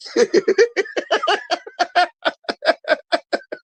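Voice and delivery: drawn-out high giggling; long high laugh. Real, not acted. Host still cracking up at the chat joke. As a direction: let the laugh run on.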